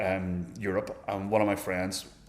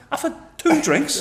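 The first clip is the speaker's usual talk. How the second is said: exasperated protest; 8 dB louder, slower than usual. Retold or imitated.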